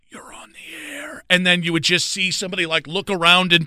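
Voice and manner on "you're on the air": breathy, toneless whisper; prank whisper. Retold or imitated. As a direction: hiss the words as a sneaky prank.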